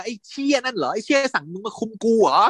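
mock angry snap at whoever sent her; angry outburst. Furious man told he is being watched, mock angry retort. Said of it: "ก็แบบโกรธใหญ่เลยว่า".

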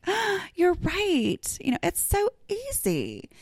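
high, slow sarcastic "you're right"; sarcastic agreement. Retold or imitated. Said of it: "And I'm like".

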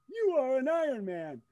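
high, loud, slow finish-line call; the announcer voice let loose. Retold or imitated. Shouting from the car window to a cyclist in an Ironman jersey, slow booming announcer call. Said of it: "I let the voice loose".

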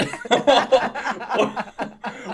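long, high burst of laughter; real giggling fit. Real, not acted. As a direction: crack up helplessly. Cracks up while explaining.

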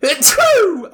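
a loud real sneeze; sneezing. Real, not acted. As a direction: sneeze sharply, high and loud. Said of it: "hold on, I'm gonna sneeze".

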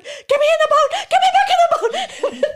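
yelled high and loud through laughter; laughing shout. Retold or imitated. Panicked tourist in the ocean, laughing shout. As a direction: scream to be pulled back aboard. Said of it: "I freaked out".

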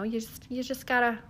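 lightly put-on Minnesota accent; Cheryl Lipinski accent. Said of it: "do it with that Cheryl Lipinski accent".

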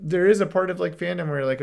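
rising up to make the point. Real, not acted. Fan frustration building loudly.

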